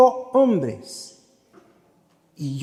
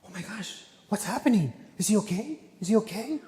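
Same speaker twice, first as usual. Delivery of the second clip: higher, softer panicked English; mock worried teens; 5 semitones higher, 7 dB quieter than usual. Teens fret over fainted boy, mock worried voice. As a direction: imitate the upset youths fretting.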